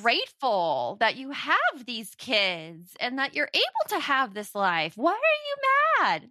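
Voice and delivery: overbearing nagging, soaring at the end; scolding inner critic. Acted. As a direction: build to a high, drawn-out scolding question. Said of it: "that's the voice".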